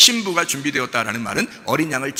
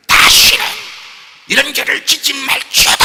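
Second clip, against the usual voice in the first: loud, high, drawn-out mock condemnation; hammed-up booming commandment; harsh, forceful rebuke; 10 semitones higher, 9 dB louder, slower than usual. Shown as a demonstration. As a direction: thunder it slowly like a stern judge. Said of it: "세게 얘기한 것 같진 않아요".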